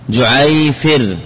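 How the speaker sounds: جعيفر said slowly, syllable by syllable; drawn out جعيفر for the class; slow demo of جعيفر. Shown as a demonstration. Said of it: "فتقول فيه جعيفر".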